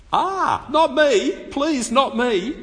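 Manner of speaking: high, pleading mock whine, slowing; whiny reluctant Gideon. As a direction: beg to be let off like a timid man.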